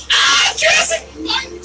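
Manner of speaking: high, loud burst of excitement; excited shout. Real, not acted. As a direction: scream the park name like a fan. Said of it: "I just get really excited".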